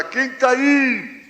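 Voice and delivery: grandfather's drawn-out, high-pitched holler; calling out. Retold or imitated. Grandfather hollering to the kitchen at dawn, drawn-out shout. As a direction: yell it across the house, stretching the last word. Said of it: "Aí ele gritava de lá".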